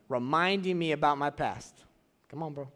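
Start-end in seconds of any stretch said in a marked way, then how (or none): none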